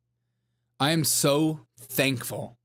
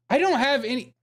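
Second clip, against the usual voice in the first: much higher put-on voice; high-pitched acting; 10 semitones higher than usual. Streamer acting out a bit about missing mayo, high-pitched. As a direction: say it way up high, hammed-up. Acted bit within stepmother impression.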